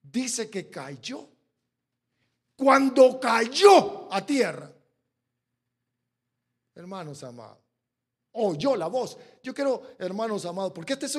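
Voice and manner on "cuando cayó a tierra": shouted, slow and heavy; preaching shout. Real, not acted. Pastor preaching to the congregation, shouting slowly. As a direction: cry it out to the church.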